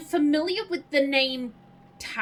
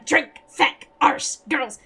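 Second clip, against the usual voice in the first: lightly voiced, barked one-word outbursts; a hint of the character's loud single-word demands; quick impression, each word punched out on its own; Father Jack; 6 dB louder, slower than usual. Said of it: "who played Father Jack".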